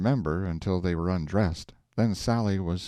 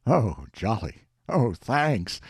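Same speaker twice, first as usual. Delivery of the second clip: a louder burst of glee; husband overjoyed at the money, louder; delighted; 3 semitones higher, 4 dB louder, slower than usual. A narrator's reading. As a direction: cry out your thanks with joy. Sally delighted by the news.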